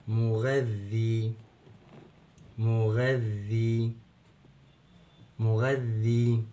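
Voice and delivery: مغذي said very slowly, doubled ذ and drawn-out ee; long ya. Shown as a demonstration. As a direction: stretch the final ee of مغذي. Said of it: "المد الثالث وهو مد الياء". (مدّ الياء في آخر مغذي ببطء)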